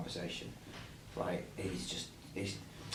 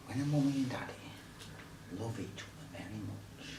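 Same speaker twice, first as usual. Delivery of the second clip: whispering; 2 semitones higher, 7 dB quieter than usual. Acted. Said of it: "Don't worry, excuse mine".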